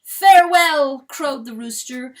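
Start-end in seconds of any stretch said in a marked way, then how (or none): 0.0-1.0 a loud, high, ringing farewell; ringing crow. Acted. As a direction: crow it out full and loud, like a rooster. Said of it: "a ringing crow".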